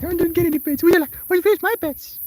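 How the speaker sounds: very high, loud whiny cat voice; voicing the cat. Streamer voicing her cat's whiny demand, very high and loud. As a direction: whine like a jealous pet. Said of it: "You can't be like".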